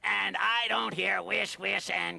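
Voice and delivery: grumpy sleepy raccoon. Acted. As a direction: woken raccoon grumbling. Raccoon low.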